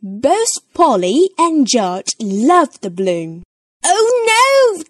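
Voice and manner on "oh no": put-on very high, loud toddler wail; dismayed cry. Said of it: "George cries".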